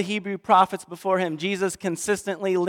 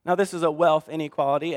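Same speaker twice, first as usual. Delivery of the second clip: usual speaking voice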